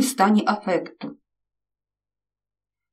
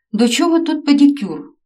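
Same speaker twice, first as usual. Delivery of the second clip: puzzled; 7 semitones higher, 6 dB louder than usual. A narrator's reading. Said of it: "не зрозумів Роман".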